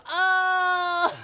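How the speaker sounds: loud high cry breaking into laughs; overcome, burst out then laugh; emotional shout into laughter. Real, not acted. Overwhelmed by emotion.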